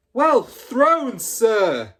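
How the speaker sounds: a pupil cheering the throw from afar; shouted cheer. Acted. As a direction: cheer from across the yard. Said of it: "someone shouted".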